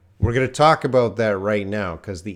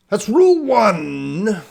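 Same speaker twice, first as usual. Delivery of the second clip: excited announcement; 10 semitones higher, 7 dB louder, slower than usual. Real, not acted. Teacher announcing rule.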